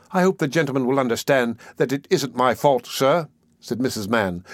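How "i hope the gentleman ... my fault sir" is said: plain reading voice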